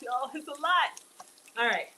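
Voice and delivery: normal talking voice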